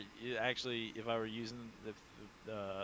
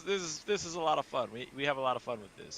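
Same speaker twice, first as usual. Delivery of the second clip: ordinary talk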